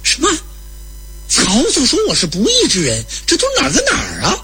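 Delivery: high, bewildered questions; acted incredulous protest; baffled. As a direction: react in utter confusion, pitch leaping up. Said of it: "吕布越听越糊涂".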